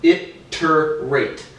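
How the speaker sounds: "Iterate" drawn out as it-ter-rate; syllable by syllable. Shown as a demonstration. Demonstrating three syllables, "Iterate" said slowly in pieces. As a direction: slowly give each part of "Iterate" its own beat. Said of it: "break that word down into three syllables".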